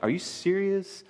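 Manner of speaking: high, drawn-out disbelieving question; slow, high-pitched incredulity; incredulous. Acted. Disciples' disbelief, acted out.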